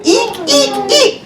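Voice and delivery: high squeaks like a shoe; rubbery high squeaky toy. Pretend.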